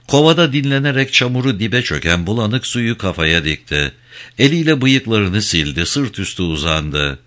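usual speaking voice

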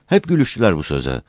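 ordinary delivery

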